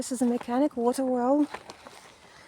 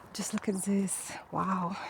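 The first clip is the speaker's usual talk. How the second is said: hushed unvoiced whisper; 4 semitones lower, 10 dB quieter than usual. Real, not acted. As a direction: whisper so nobody nearby hears. Stranger hiding from a resident.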